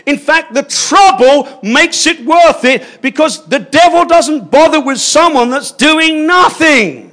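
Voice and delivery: a passionate preacher rising to a peak; fervent preaching. Real, not acted. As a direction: preach it at full force. Preaching at full force.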